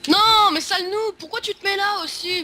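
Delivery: yelling at a noob in anger; angry shout. Real, not acted. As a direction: shout him down, furious. Insulting a player in game.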